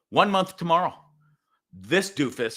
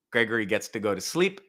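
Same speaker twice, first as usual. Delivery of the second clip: ordinary delivery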